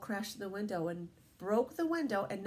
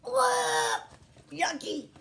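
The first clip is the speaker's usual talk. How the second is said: high, squeamish "ugh"; playful disgust; 12 semitones higher, 4 dB louder, much slower than usual. Pretend. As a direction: recoil as if tasting dog treats. Reacting to eating dog treats.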